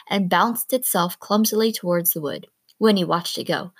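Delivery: plain reading voice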